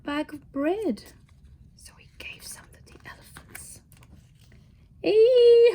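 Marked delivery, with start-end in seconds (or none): 1.8-3.8 whispered storytelling aside. Real, not acted. Reader's aside to child listener. 5.0-5.7 loud, high yelp of pain; howl. A narrator's reading. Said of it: "howled Mister Magee".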